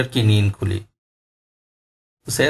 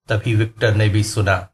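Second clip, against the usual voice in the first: ordinary delivery, nothing marked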